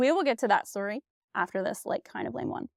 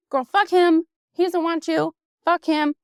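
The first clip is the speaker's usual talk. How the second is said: louder, higher sassy friend; imitating a bitter friend hyping her up; mock girlfriend rant; 6 semitones higher, 4 dB louder than usual. Friend telling her to dump him, mock loud rant. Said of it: "somebody who's in a fucked up position is gonna be like".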